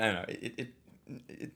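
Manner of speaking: ordinary delivery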